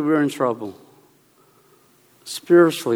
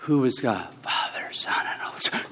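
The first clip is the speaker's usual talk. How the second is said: mock whisper; 4 semitones lower, 10 dB quieter than usual. Mocking a dumbed-down exam answer.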